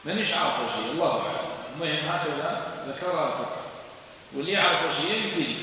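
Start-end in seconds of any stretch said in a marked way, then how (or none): none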